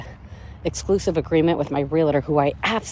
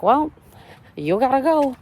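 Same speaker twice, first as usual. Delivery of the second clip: mock official; 8 semitones higher than usual. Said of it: "they're gonna be like".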